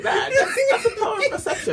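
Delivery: amused, talking through real giggles; laughing. Real, not acted. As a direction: laugh your way through the words. Amused by the retold response.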